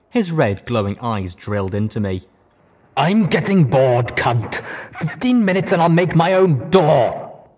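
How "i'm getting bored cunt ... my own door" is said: slow, loud, threatening brute; menacing raider boss. A narrator's reading. The raider boss Deus threatening the captive mare. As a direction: lean in and threaten slowly.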